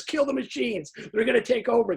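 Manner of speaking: high, alarmed mock outcry; upset alarm. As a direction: play the panicked Victorian crying doom. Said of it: "'cause he's all upset".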